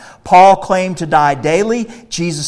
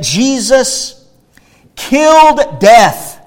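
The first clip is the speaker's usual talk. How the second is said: higher, louder and slow, each word stretched; slow proclaiming shout; 7 semitones higher, 6 dB louder, much slower than usual. Acted. Preacher to his congregation on the cross, slow triumphant shout. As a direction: ring it out as a triumphant declaration.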